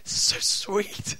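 slow, breathy whisper of delight; hushed and slow, so sweet; tender whisper. Retold or imitated. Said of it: "I'm like".

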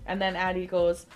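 ordinary talk

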